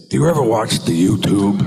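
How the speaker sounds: lightly voiced Pacino, laughing; a hint of Pacino asking; Pacino impression. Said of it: "and he would say".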